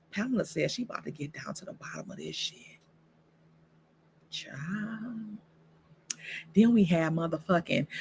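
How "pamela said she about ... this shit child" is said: hushed gossip whisper; conspiratorial whisper to viewers; whispered aside. Real, not acted. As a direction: lean in and whisper it.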